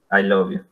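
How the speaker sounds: I LOVE YOU low, level and listless; without enthusiasm. Shown as a demonstration. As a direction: recite I LOVE YOU as if you don't care. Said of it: "¿Cómo lo dicen ustedes así sin ganas?". (I LOVE YOU sin ganas, en tono neutro)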